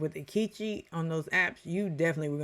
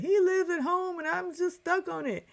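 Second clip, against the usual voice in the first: much higher, whiny and dismayed; mock upset girlfriend; 14 semitones higher than usual. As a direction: squeal it like a woman stuck on a red flag. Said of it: "She's like".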